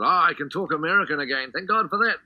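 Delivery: a loud, high, lightly put-on American accent; relieved actor slipping back into an American accent. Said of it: "converted into American accent".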